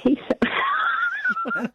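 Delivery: laughing. Real, not acted. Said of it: "I sounded like a smoker then, that laugh".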